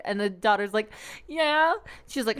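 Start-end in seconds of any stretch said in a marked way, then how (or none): 1.3-1.7 put-on high, rising small-child answer; pitched-up mock pout; tearful little kid. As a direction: answer like a pouting child, imitated. Imitating the tearful little goddaughter.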